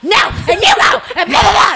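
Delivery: very high-pitched mock rant; retold yelling. Housemate yelling on during the phone call, imitated shrieking rant. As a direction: imitate someone ranting on obliviously. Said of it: "And she's all".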